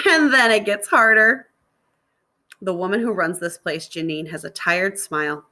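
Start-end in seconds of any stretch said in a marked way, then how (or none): 0.1-1.5 laughing joke. Acted. Girl laughs, then says it.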